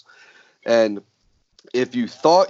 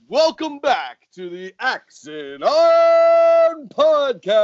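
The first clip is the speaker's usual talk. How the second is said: slow booming ringmaster announcement; 8 semitones higher, 3 dB louder, slower than usual. Acted. Podcast intro announcement.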